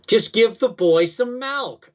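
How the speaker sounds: high, slow hammed-up Midwest drawl; exaggerated Midwest accent. Shown as a demonstration. Joking example of Midwest exceptions, exaggerated Midwest accent. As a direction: stretch it high with "milk" as "melk", Midwest caricature. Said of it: "milk will tend to have a little more E".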